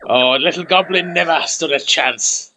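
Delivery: loud, high crow of victory, drawn out slowly; mock gloating. Streamer crowing over a beaten goblin, mock gloating.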